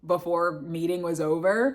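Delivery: normal talking voice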